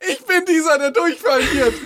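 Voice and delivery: student's inner panic: ich bin dieser, said in a high mock wail. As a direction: squeal it like the doomed student. Acting his panicked exam thought.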